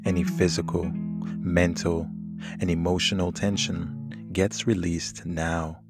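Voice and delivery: calm and soothing over the music. Acted. Leads a guided meditation.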